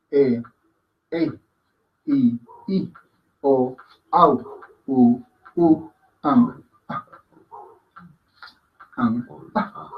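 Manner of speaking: quiet separate vowels, "E, E, I, I" unforced; soft sounds. Shown as a demonstration. Teacher drilling gentle vowels, soft recitation. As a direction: recite softly, closing "Ang" in the throat. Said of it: "son sonidos suaves".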